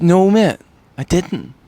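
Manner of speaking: high, loud opening protest, then easing; retold protest. Retelling his denial of pushing someone, protesting.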